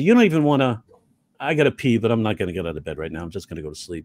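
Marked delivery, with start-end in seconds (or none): 1.4-4.0 very low, quiet half-asleep mumble; tired and lazy. Acted. Comic playing someone too lazy to leave bed, tired low mumble. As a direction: sink down into a sleepy, barely-bothered voice. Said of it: "if you're really tired or you're lazy".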